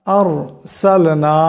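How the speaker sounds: আরসালনা very slowly, র heavy and full. Shown as a demonstration. Teacher repeating আরসালনা slowly to show the heavy র. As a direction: stretch out আরসালনা with a thick র. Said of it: "মোটা আওয়াজে হবে".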